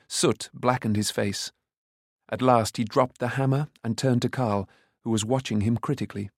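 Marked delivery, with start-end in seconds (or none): none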